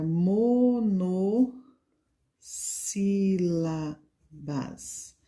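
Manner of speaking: "monossílabas" very slowly, broken into syllables. Shown as a demonstration. Teacher writing the term for children, "monossílabas" syllable by syllable. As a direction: draw out each syllable of "monossílabas" with gaps.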